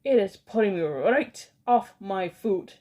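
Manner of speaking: loud, disgusted villain rant. Acted. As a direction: rage at the revolting children. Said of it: "cried the Grand High Witch".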